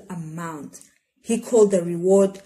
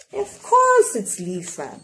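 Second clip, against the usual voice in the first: higher, bright playful answer; storyteller revealing the girl to listeners, playful; 8 semitones higher than usual. Acted. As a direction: reveal it with a flourish. Answering own rhetorical question.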